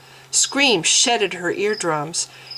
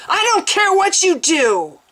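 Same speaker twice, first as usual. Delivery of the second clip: high, loud enraged order; furious yell; 12 semitones higher, 4 dB louder than usual. A narrator's reading. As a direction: lash out at them. Mens striking attendants.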